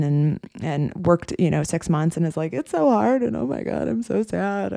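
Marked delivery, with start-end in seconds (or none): 2.5-4.7 higher, put-on whine; mock whiny new grad. Coach mocking a new grad's whining, higher and whiny. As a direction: parody a complaining rookie.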